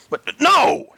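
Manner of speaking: high, loud alarmed no; gasped protest. A narrator's reading. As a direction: gasp it in panic. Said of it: "Harvey gasped".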